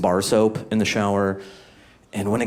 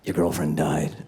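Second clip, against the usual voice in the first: somber cop; 5 semitones lower, 5 dB quieter than usual. Retold or imitated. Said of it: "they're like".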